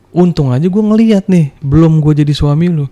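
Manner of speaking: angry retort. Retold or imitated. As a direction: fire it back at her, furious. Heated retort in quarrel. (balasan marah, lebih keras dan tinggi)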